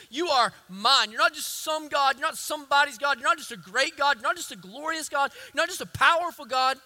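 high, loud declaration to God; David's fervent cry. Acted. David speaking to God, in a loud fervent cry. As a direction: proclaim it like a man claiming his God. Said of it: "and he says".

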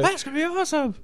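put-on high, breathless kid delight; excited kid. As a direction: gush like a thrilled kid. Excited kids hearing news.

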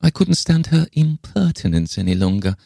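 put-on high, aggrieved old woman's voice; querulous eccentric old lady. A narrator's reading. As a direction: complain in a high, peculiar old voice. Le Fane complains of the other woman.

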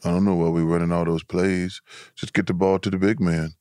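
very low-pitched mumbling big-man impersonation; deep, low imitation of a huge ballplayer; Shaq. Shown as a demonstration. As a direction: drop way down deep, softly grumbling. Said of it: "can you imitate him".

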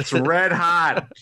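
slow, drawn-out hype line; wrestling promo. Pretend. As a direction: stretch it out like a ring promo. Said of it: "holding the mic to his face like he's The Rock".

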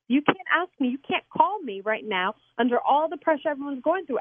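normal talking voice

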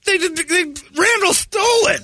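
high, slow outraged shout; mock outrage, up high and drawn out; indignant shout. Real, not acted. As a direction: wail that he stole it. Indignant outburst at rival.

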